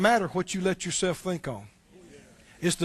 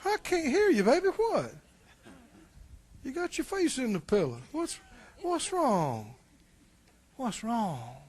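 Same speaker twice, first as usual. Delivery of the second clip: mock coaxing wife; 2 semitones higher, 6 dB quieter than usual. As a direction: tease like the wife leaning in. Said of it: "she said to him".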